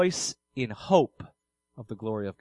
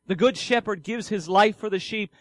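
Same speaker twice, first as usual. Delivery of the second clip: usual speaking voice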